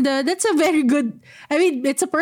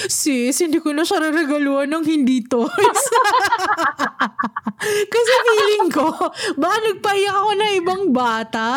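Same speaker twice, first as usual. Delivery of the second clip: laughing; 5 semitones higher than usual. Real, not acted. Laughing while retelling her promise.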